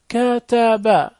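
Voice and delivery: كتب drawn into three even syllables; sounded out slowly. Shown as a demonstration. Teacher reading to first graders, sounded out slowly. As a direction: stretch out every syllable of كتب.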